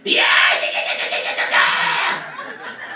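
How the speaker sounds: loud, raspy-free breathy rock-star yeah yeah shouts. Retold or imitated. Rock singer greeting a fan with loud yeahs.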